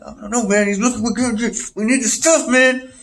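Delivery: high, strained pleading; mock-anxious buddy in need; worried stoner. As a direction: voice climbing as the craving grows. Acting out friends missing their supplier.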